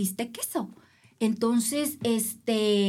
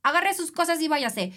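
a curt, higher official voice; imitating the official; 6 semitones higher than usual. Race judge telling the swimmer she is out, curt mock official. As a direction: play the judge throwing her out, lightly voiced. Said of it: "me dice".

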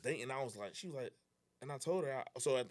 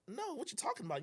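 high-pitched puzzled protest, softer; baffled denial; 12 semitones higher, 6 dB quieter than usual. Retold or imitated. Answering girlfriend who says there's a problem, baffled. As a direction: retell your confused comeback.

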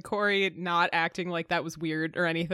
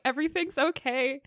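imitated nervous self-reassurance, pitched up; fearful pep talk; 6 semitones higher than usual. Imitating the girl's pep talk to herself, mildly fearful. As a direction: lightly mimic someone fearfully reassuring herself. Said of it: "kind of fearful in her voice and tone".

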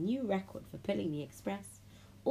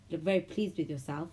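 plain reading voice

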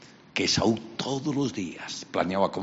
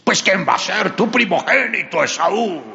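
high, loud confident son; proud and hearty; booming Esaú; 11 semitones higher, 8 dB louder than usual. Acted. Esaú announces himself.

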